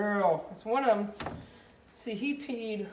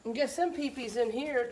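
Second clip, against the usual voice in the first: usual speaking voice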